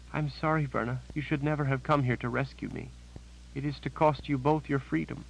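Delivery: high, shaken voice of a terrified sister; a scared woman blurting out bad news; frightened Janet. A narrator's reading. Janet voiced, tearful apology.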